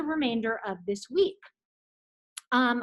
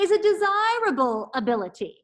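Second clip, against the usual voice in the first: careful articulation; 5 semitones higher, 4 dB louder than usual. Shown as a demonstration. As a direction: enunciate every syllable clearly. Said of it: "So repeat after me".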